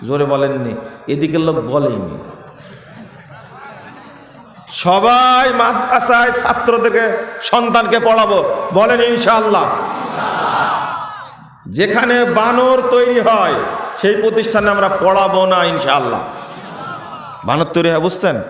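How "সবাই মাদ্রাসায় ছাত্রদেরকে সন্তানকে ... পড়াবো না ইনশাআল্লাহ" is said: pulpit call. Real, not acted. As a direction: lead the crowd in a vow. Leading crowd in a pledge. (মঞ্চ থেকে জোরালো প্রতিজ্ঞা পাঠ)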